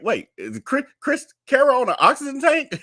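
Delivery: mock-startled question, pitch jumping up; double take. Host retells spotting Chris Carroll on screen, mock-surprised double take. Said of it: "had to do a double take".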